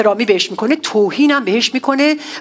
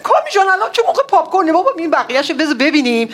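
exasperated protest; 5 semitones higher, faster than usual. Retold or imitated. Preacher quoting himself to Kami.

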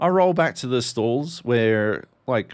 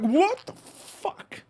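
high, slow, drawn-out disbelief; stunned outrage stretched way up high; shocked what the fuck; much slower than usual. Retold or imitated. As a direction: recoil at being touched. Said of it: "And I'm like".

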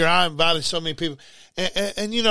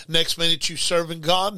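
usual speaking voice

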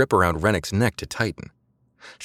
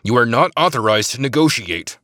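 loud, higher angry growl of an order; 6 semitones higher, 5 dB louder than usual. A narrator's reading. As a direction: growl it, furious at the insubordination. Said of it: "Rennick growled".

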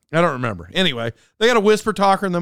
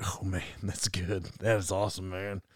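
awed listener; 10 semitones lower, 14 dB quieter than usual. Retold or imitated. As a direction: drag it out in hushed awe. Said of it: "He's like".

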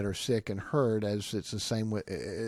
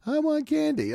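put-on much higher whining child voice; child begging for candy; whiny child; 3 dB louder than usual. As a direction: whine like a kid wanting candy.

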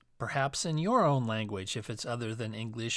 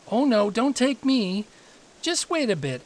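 high, louder plea; pleading middle goat; 8 semitones higher, 5 dB louder than usual. A narrator's reading. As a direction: beg the troll to hold off.

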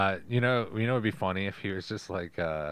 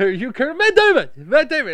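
loud, high mocking shout of the name; screaming Matt Damon in a goofy cartoon voice; Matt Damon puppet shout; 12 dB louder than usual. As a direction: yell the name like a dumb puppet.

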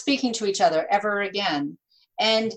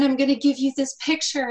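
higher-pitched, mildly excited mom; imitated excited mom; 6 semitones higher than usual. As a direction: play mom offering a gift, lightly excited. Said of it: "she was all excited".

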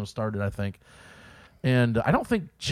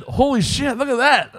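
mock amazement; 12 semitones higher, 5 dB louder than usual. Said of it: "And you said".

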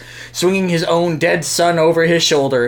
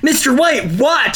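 scream; 8 semitones higher than usual. Acted. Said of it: "some weird kid screamed".

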